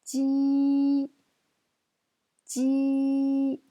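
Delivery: "Ji" held high, level and loud; first tone. Shown as a demonstration. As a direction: sustain "Ji" on one high note. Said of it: "c'est le premier ton".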